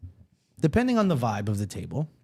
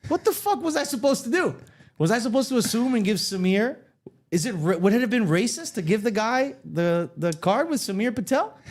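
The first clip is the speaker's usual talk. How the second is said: higher, baffled rant to himself; exasperated self-talk; 7 semitones higher than usual. Retold or imitated. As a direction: ask yourself in bewildered disbelief. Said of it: "So then I said to myself".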